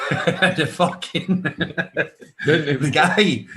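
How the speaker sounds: laughing through the words, high; cracking up mid-sentence; giggling while telling the story. Real, not acted.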